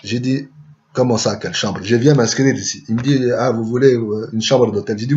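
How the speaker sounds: ordinary talk, nothing marked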